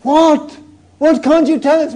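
nosy neighbours pressing; curious mourners. Acted. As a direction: mourners demanding to know what happened. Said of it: "they say to her".

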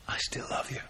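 soft whispered reassurance; tender whisper. Acted. Jesus silently reassuring Peter, tender whisper. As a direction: whisper it with love.

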